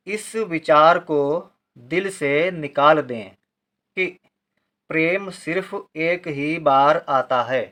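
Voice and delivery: slow, deliberate recital with long pauses; slow expressive reading. Acted. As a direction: read the passage like a poem, weighing each phrase. Teacher reads the paragraph aloud. (धीमा, भावपूर्ण पाठ)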